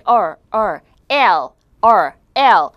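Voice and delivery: loud "R" untouched, "L" tongue-tipped; R and L contrast. Shown as a demonstration. Teacher drills viewers, loud contrast of "R" and "L". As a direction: alternate curled "R" with touching "L". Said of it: "위에는 안 붙고 양옆에는".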